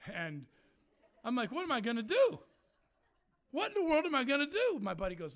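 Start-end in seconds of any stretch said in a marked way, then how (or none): 1.5-4.8 high, loud, panicky question; mock despair. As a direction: wail it in exaggerated desperation. Acted despair after firing.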